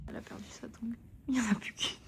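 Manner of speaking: soft unvoiced whisper. Real, not acted. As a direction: whisper it quietly. Whispering in the hotel room.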